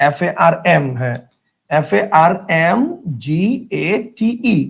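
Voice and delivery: ordinary talk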